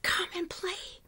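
high, soft whisper; breath-only voice, overcome with happiness. A narrator's reading. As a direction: whisper an invitation to play. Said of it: "she whispered".

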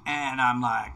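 usual speaking voice

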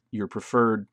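usual speaking voice

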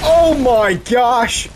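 high, drawn-out exclamation; slow, high cry of shock; shocked yell. Real, not acted. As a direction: stretch it out in loud disbelief. Shocked during the fight.

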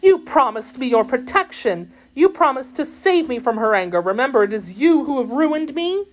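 high, distressed maid's reproach; exclaiming. A narrator's reading. Kitty reproaching D'Artagnan for ruining her, exclaiming. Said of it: "she exclaimed".

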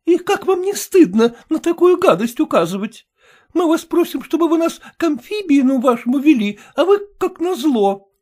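a touch of indignant scolding; indignant lady. A narrator's reading. Glafira scolds the doorman.

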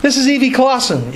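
ordinary delivery

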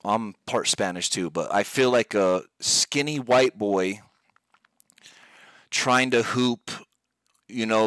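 normal talking voice

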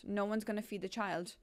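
ordinary talk

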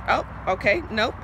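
ordinary talk, nothing marked

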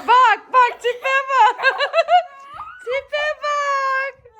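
high, loud laughing tease. Real, not acted. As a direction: laugh at the sulky face. Mocking sulking child, laughing.

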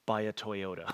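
lightly voiced ad announcer; mock ad voice. Pastor mocking a car ad for the congregation, low and soft. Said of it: "from 'buy a Toyota' to".

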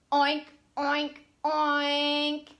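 oinking like a pig. Pretend. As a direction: grunt out the oinks. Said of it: "What does a pig say".

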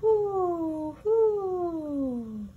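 high hooting owl call. Acted. Storyteller hooting like an owl for children.

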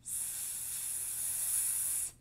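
a long unvoiced S hiss with a smile; S held like a snake. Shown as a demonstration. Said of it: "make that sound".

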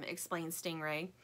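usual speaking voice

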